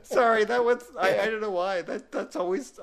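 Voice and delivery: talking through real laughter; laughing. Real, not acted. Cracking up at the punchline.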